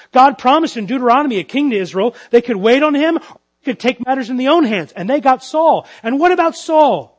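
fervent pulpit delivery; full-force preaching. Acted. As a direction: preach it out loud to the room.

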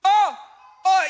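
a sudden loud cry of Oh; loud preacher's oh. Real, not acted. Preacher overwhelmed by Jesus' tears, crying out loud. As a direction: burst out with it.

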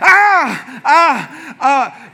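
high, loud cries, tapering off; mock pain cries. Sore man sitting on the toilet, mock pain cries. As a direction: act out sore muscles as you sit.

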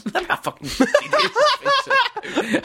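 helpless high-pitched laughter through the words; laughing. Real, not acted. Co-host cracking up at his own remark, laughing. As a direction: say it while cracking up, slowly.

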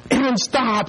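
high mock panicked plea; freaking out. Five-year-old grabbing his brother's arm, freaking out. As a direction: cry out like a frantic little kid. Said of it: "Anton's just freaking out".

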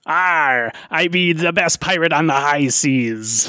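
hammed-up pirate boast; mock pirate voice with a big opening arr. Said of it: "professional pirate impersonator".

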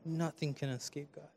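very soft, trailing near a whisper; hushed assurance. Shown as a demonstration. Preacher showing what to tell your neighbor, hushed assurance. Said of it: "give him that same look".

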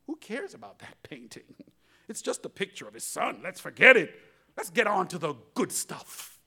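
dismissive heckler. Acted. Dismissive voice from back.

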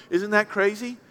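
ordinary talk

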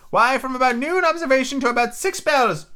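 put-on high, excitable old castaway; squeaky marooned sailor, eager and odd; lightly cracked high pirate voice; Ben Gunn voice. Ben Gunn's voice in the story.

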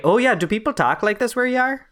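high, put-on Minnesota mom accent. Asking co-host about local speech, mock Minnesota accent. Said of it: "you're going for the Minnesota trope".

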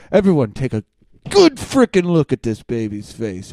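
loud, exasperated insistence; emphatic demand. Acted. Ranter orders everyone to look at the baby photo, loud insistence. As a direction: bellow it at everyone watching.

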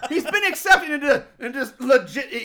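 high, loud laughing rant. Real, not acted. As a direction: laugh through the words. Laughing in disbelief.

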